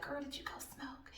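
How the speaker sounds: hushed high whisper, then half voice. Retold or imitated. Coworker whispering to her about smoking on break. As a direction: sneak the question in a whisper.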